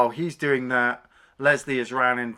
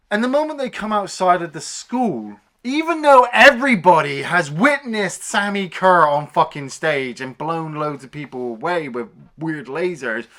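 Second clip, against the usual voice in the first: usual speaking voice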